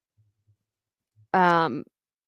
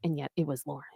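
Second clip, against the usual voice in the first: a hushed secret whisper, much softer; 4 semitones lower, 11 dB quieter, much faster than usual. Retold or imitated. She's sure it was Lauren, whispered. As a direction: breathe the name like a secret. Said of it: "she's like".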